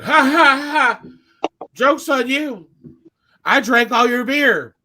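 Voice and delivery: loud, exaggerated taunting laugh and boast; mock drunken gloating. Drunk customer taunts the bouncer, gloating loudly. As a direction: imitate a drunk crowing in triumph.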